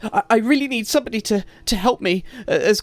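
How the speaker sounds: high, loud, fast stammering; rushing to beg the heroes along; frantic farmer woman. Acted. Distraught farmer woman urges the party to hurry, stammering fast and high.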